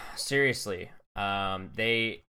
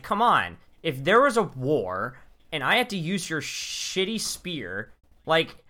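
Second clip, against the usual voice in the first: exasperated rant; 7 semitones higher, 5 dB louder than usual. Real, not acted. Ranting about weak weapons.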